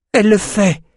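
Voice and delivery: high-pitched cry of warning; alarmed cry. A narrator's reading. Companion warns the group of the magic attack, crying out high. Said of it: "cria Nietzsche".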